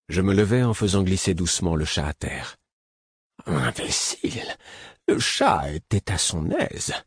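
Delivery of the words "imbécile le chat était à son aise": low, slow, whispered grumble of a cat; a sulky cat grumbling at being tipped off; complaining cat. A narrator's reading. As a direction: chat qui râle tout bas. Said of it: "se plaignit Fenouil".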